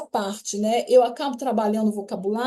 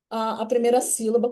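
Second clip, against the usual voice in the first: usual speaking voice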